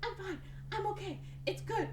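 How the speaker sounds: high-pitched imitated reassurance; mock protesting. Patient told she may have concussion, mock protesting. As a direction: brush off concern in a high, put-on voice. Acts patient waving off concern.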